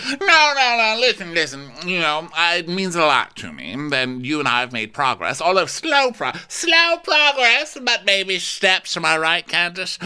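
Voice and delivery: high, loud, hammed-up plea; over-the-top pleading. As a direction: plead insistently in a put-on high voice. Over-the-top acted speech to Candace.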